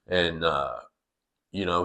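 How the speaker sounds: normal talking voice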